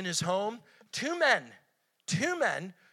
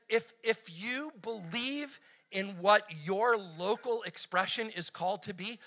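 usual speaking voice

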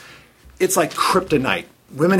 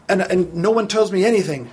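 usual speaking voice

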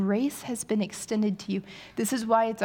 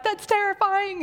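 much higher, mock-scared squeak; hammed-up fright, much higher; mock terrified; 12 semitones higher, 4 dB louder than usual. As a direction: play it up as a scared wife. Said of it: "And I'm like".

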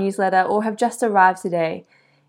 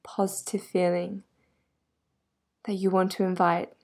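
calm, measured yoga nidra voice with long pauses; guided meditation. Real, not acted. Yoga guide helping a listener get comfortable, guided meditation. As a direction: settle the listener gently, leaving space between phrases.

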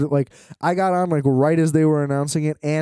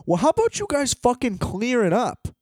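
high-pitched, indignant outburst; exasperated demand; 9 semitones higher than usual. Acted. Ranting at train staff about waiting for clearance, exasperated demand.

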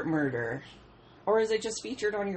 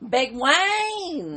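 excited call; 8 semitones higher, 6 dB louder than usual. Real, not acted. Teasing call of friend's dad.

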